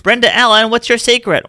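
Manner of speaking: high, loud gushing interviewer voice; eager reporter. Pretend. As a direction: call out like a newsreel reporter. Mock interviewer questioning Brenda.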